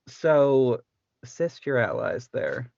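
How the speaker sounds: ordinary talk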